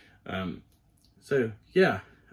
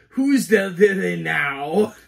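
slow, higher, louder villain taunt; gloating villain; 4 semitones higher, 6 dB louder, slower than usual. Pretend. Cosplayer in new cowl taunts Flash, gloating villain. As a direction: draw out a playful villain's taunt.

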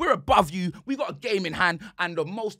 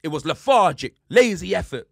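usual speaking voice

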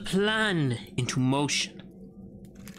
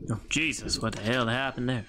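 usual speaking voice

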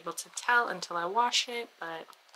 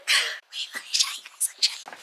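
whisper. Real, not acted. About to reveal something.

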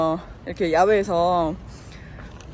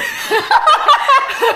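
high laugh; 7 dB louder than usual. Real, not acted. Laugh alone on line.

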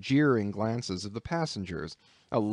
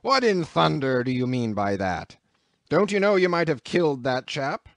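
louder, rising furious rebuke; angry captain; 4 semitones higher, 5 dB louder than usual. A narrator's reading. Captain scolding Queequeg over the thrown man, angry. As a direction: tear into him for the near killing.

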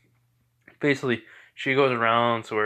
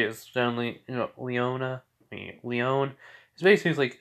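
ordinary delivery

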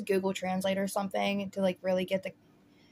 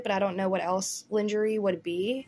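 ordinary talk, nothing marked

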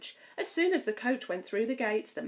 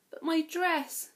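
worried; 9 semitones higher, slower than usual. Acted. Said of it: "said Cinderella".

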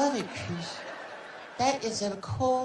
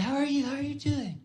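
Michael greeting; 5 semitones higher than usual. Retold or imitated. Michael greeting guests in his living room, lightly voiced. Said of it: "Michael be saying".

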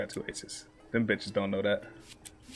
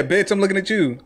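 normal talking voice

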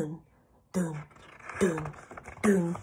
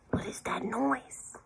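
soft, higher-pitched whispery question; hushed and curious; hushed wondering; 5 semitones higher, 10 dB quieter than usual. Acted. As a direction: whisper it, as if listening out. Wondering at a sound in story.

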